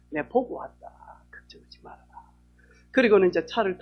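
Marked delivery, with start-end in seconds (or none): 1.3-2.3 whisper. Retold or imitated. Sister comforting after vision.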